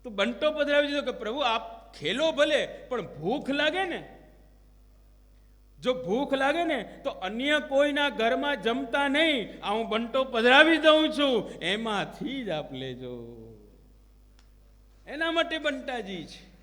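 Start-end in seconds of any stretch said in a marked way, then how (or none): 1.1-11.5 building from loud to much louder as the offering is made; rising devoted insistence, loudest at the end; fervent devotee plea. Acted. Devotee offers food to Lord. 11.5-13.4 tender request. Acted. Devotee finishes offering tenderly.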